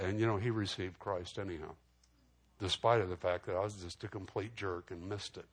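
ordinary talk

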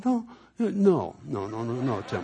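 high-pitched refusal, then dropping with a laugh; mock alarmed protest. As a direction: object in exaggerated alarm, then ease off. Retold protest to friend.

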